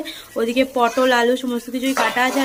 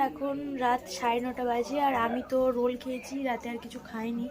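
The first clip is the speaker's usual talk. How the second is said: speaking softly; 11 dB quieter than usual. Real, not acted. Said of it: "আর আমি একটু আস্তে আস্তেই কথা বলছি".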